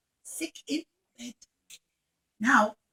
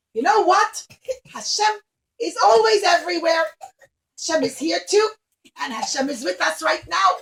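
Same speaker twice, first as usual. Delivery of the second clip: loud, higher, ringing with faith; fervent declaration; 3 semitones higher, 8 dB louder than usual. Acted. Rabbi Akiva proclaiming Hashem will be his guarantor, fervent. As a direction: proclaim it like a rousing sermon.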